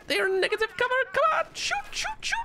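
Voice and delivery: high frantic shoot shoot; frantic urging. Real, not acted. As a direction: squeal at them to shoot.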